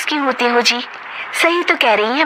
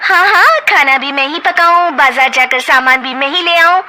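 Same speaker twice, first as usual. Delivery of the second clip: heated quarrel; 9 semitones higher than usual. Acted. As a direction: snap back and forth. Sisters-in-law quarrel.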